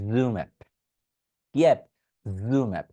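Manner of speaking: জুম অ্যাপ with a buzzing z; voiced z demo. Shown as a demonstration. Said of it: "এই সাউন্ডটা create করে নিতে হবে". (জেড ধ্বনি দিয়ে জুম অ্যাপ)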